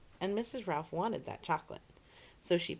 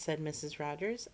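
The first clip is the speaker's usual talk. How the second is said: plain reading voice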